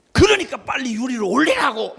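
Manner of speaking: laughing while imitating the furious worker; angry order. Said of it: "그랬더니". (웃으며 흉내 낸 호통)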